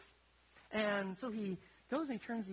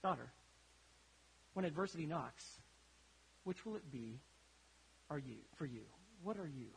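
softer, lower fatherly question; gentle father; 4 semitones lower, 5 dB quieter than usual. Retold or imitated. Chef father asking his daughter, softer and lower. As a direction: lay the lesson on her gently. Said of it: "And so he said".

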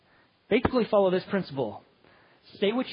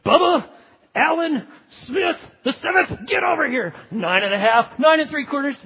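much higher, loud mock shout; hammed-up furious parent shout; full-name yell; 7 semitones higher, 6 dB louder than usual. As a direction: bellow the child's full name. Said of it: "you use their full legal name".

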